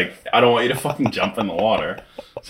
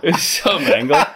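laughing; 9 semitones higher than usual. Real, not acted. Laughing comment.

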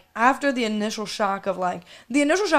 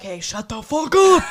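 louder, slow mock lawyer voice; imitating lawyer; 7 dB louder than usual. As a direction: growl it like an annoyed lawyer. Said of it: "my lawyer watches this and he's like".

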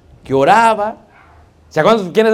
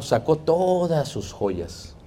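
ordinary talk

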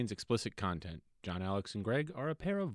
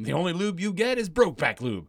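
booming jokey shout; 7 semitones higher, 7 dB louder than usual. Pretend. Hamming Brokeback jokes loudly.

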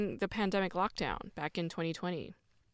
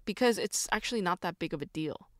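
ordinary delivery

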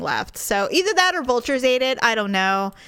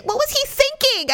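very high incredulous question; worked-up disbelief. Retold or imitated. Couple arguing over dead squirrel, worked up. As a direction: squeal it in retold exasperation.

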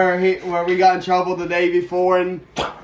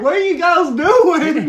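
mock teacher shout; 9 semitones higher, 6 dB louder, slower than usual. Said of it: "Miss Judy's like".

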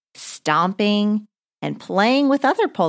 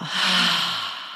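drawn-out breathy release; showing how to exhale; long exhale sigh; 4 dB quieter than usual. Shown as a demonstration. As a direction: push the air out in a long sigh.

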